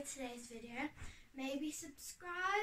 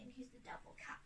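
whispering; 10 dB quieter, faster than usual. Real, not acted. Whispered aside about cat.

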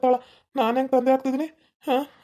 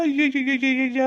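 drawn-out wail. Acted. Husband laments his fate.

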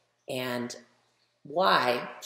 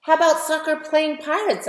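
eager, much higher and louder; eager suggestion; 13 semitones higher, 10 dB louder than usual. Acted. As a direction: pitch the idea brightly to friends. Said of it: "I suggest".

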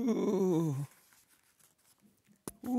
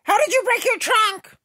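much higher and louder, demanding; exasperated shout; 11 dB louder than usual. Acted. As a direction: yell it out of patience. Friend loses patience, repeats question.

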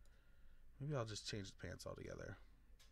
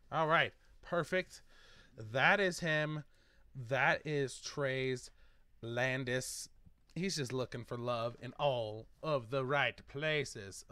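louder, slow, showy presentation; proud reveal; 3 semitones higher, 9 dB louder, slower than usual. Acted. Showing off the finished sim to viewers, a loud proud reveal. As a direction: present him like a big reveal.

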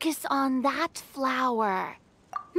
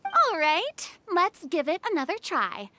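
ordinary talk, nothing marked

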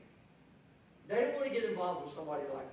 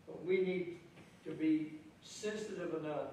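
usual speaking voice

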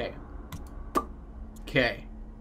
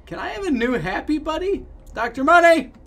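calling for help; 8 semitones higher than usual. Acted. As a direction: mock-desperate appeal for a replacement. Player calls out to Doctor Money.

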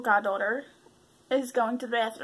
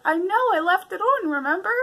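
a touch of exasperation, raised; impatient reminder; 8 semitones higher, 4 dB louder than usual. Retold or imitated. Aunt reminding the goddaughter she left it on, mildly exasperated. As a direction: remind her, a bit put out. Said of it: "I'm like".

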